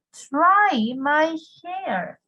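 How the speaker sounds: "Try my hair" drawn out very slowly, stress on "Try"; slow drill. Shown as a demonstration. Teacher modelling a new phrase slowly for students. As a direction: stretch "Try my hair" for the class to repeat. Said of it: "¿Qué creen ustedes que significa try my hair?".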